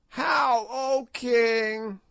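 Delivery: high crone voice, defiant and mocking; ancient witch. A narrator's reading. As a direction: play a spiteful ancient witch, gloating. Old witch Gagool voiced.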